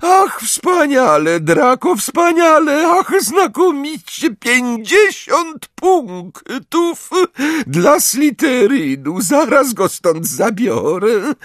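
very high, drawn-out gushing voice; overjoyed Umbridge. A narrator's reading. Professor praises Draco for catching Harry, overjoyed. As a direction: coo and crow with girlish glee. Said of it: "Jeszcze nigdy nie widział Umbridge tak uradowanej".

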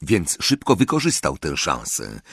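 ordinary delivery, nothing marked

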